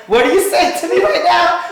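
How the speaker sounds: half-laughing outrage at the driver; laughing disbelief. Retold or imitated. Incredulous at gas stop.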